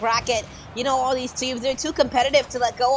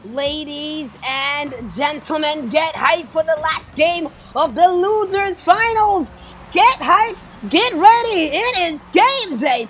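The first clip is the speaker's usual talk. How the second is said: slow loud arena announcer; announcer hype; 4 semitones higher, 9 dB louder, slower than usual. Acted. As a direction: hype the crowd slowly and loud. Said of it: "hype announcement".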